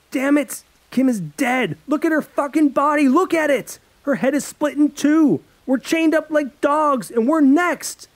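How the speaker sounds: high, loud furious shouting; girl screaming at her friend over a corpse. Acted. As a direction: scream at him in horror and rage. Paige screaming at Alex over body.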